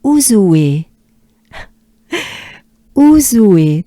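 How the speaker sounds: Ouzoue run together as "ou-zoué"; loud, deliberate Ouzoue gliding into oué; linked syllables. Shown as a demonstration. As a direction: link Ouzoue with no break before é. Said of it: "we try to link it together".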